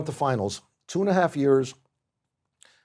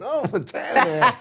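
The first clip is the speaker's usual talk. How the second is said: laughing recognition; 9 semitones higher, 4 dB louder, slower than usual. Retold or imitated. Coworkers recognizing his nickname.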